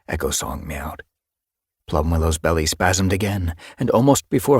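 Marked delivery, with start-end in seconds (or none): none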